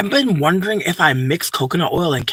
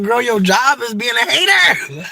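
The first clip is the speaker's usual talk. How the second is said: laughing retort; 11 semitones higher, 4 dB louder, slower than usual. Retold or imitated. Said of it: "And it's like".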